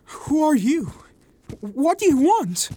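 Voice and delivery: high, loud, alarmed questioning; startled weak little man. A narrator's reading. Little man stopped from behind by a stranger, alarmed and high-pitched. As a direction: challenge the stranger in a panicky high voice. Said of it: "A weak little man".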